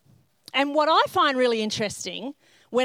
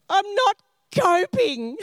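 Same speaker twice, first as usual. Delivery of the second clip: high, slow, overwrought wail; hammed-up meltdown; 8 semitones higher, 3 dB louder, slower than usual. Wife breaking down to husband, hammed-up wail. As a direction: wail it out in mock distress. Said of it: "and I said".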